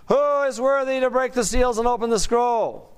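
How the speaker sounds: high, ringing call of a herald; loud angelic proclamation. Acted. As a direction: announce it aloud like a mighty angel. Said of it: "announced in a loud voice".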